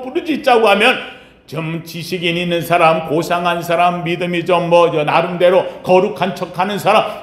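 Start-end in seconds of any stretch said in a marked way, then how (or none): none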